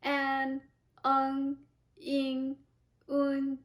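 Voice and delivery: "An, eng, ing, un" each closing in a nasal, held evenly; front nasal finals. Shown as a demonstration. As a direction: end each of "An, eng, ing, un" through the nose. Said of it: "so it's front nasal sound".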